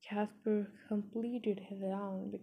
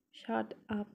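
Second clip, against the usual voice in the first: ordinary delivery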